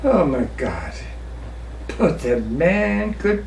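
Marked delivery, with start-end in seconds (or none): none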